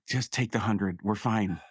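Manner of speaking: ordinary delivery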